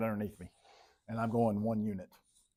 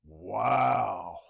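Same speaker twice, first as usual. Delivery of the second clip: louder, exaggerated incredulity; mock disbelief; 6 dB louder than usual. Teacher to class about test questions, mock outrage. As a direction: sound scandalised by the test makers.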